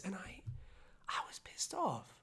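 hushed, pressed, breathless whisper; angry whisper. Real, not acted. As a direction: whisper it like confessing anger. Whispered anger admission.

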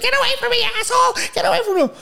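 very high, shrieking mock woman; imitation of a shrill, screaming lady; screamed insults. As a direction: screech it like an irate stranger, hammed-up. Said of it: "like, really loud".

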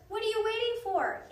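high, loud exasperated demand; impatient urging. A narrator's reading. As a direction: push them to get moving. Annie urges people who won't move.

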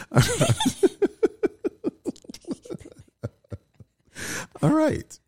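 the voice keeps breaking upward; voice cracking. Real, not acted. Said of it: "there it goes".

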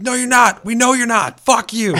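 high, loud mocking fan yell; mock angry fans. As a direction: shout them down in a hammed-up voice.

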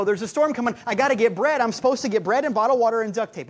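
loud frantic mock panic; panicky shopper. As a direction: ham up a frantic storm shopper.

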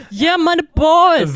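loud, slow, high cheer; excited cheer. Real, not acted. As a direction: cheer them on like a fan. Cheering for the party.

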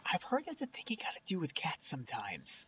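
whispering. Real, not acted. Aside in podcast chat.

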